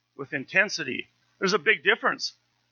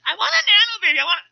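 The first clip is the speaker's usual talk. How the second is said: put-on much higher, louder little-girl pleading; whiny little girl; 7 dB louder, faster than usual. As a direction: squeal it like a begging child. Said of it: "She goes".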